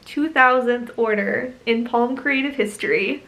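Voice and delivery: ordinary talk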